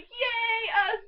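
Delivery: high, loud yay; delighted cheer. Retold or imitated. Fan hearing that voice in the movie, delighted cheer. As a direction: let out a giddy little cheer. Acts out her delighted reaction.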